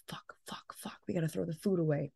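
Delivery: whispered, fast inner panic; frantic whispered curse; panicked whisper. Retold or imitated. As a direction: hiss it to yourself as if caught. Panicked thought in her head.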